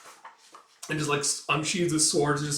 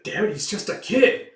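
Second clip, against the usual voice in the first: higher, louder exasperated curse; frustrated shout; 8 semitones higher, 7 dB louder than usual. Acted. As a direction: curse in disbelief. Soldier frustrated.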